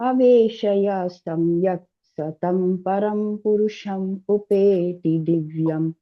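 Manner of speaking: devotional chant of the shloka; Sanskrit verse recitation. Acted. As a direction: intone the verse line by line.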